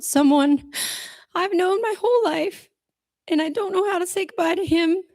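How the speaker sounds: high, tight voice fighting back tears; choking up while remembering dad; tearful grieving thanks. Real, not acted.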